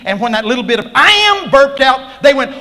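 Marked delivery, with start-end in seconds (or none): 1.0-1.5 very high, loud burst; sudden booming high declaration; divine proclamation. Retold or imitated. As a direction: blast it out with authority.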